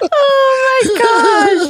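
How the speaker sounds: high, slow laughing cry of oh my gosh; real burst of laughter on the words; laughing exclaim. Real, not acted. Reacts to friend's story laughing.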